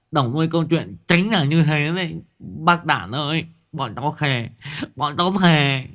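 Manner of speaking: high, fast pleading lisp rising to a squeal; lisping boy. Acted. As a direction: swear breathlessly to the old man. Lisping boy swears to Đạn. (thằng Ngọng thề thốt, giọng cao ngọng, nhanh dần)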